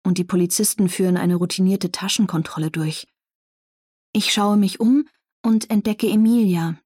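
ordinary delivery

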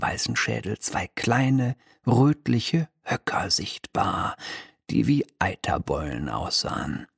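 plain reading voice

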